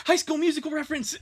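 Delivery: fast, high and loud, thrilled to spot it; excited shout. Real, not acted. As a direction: blurt it out like you just caught the joke.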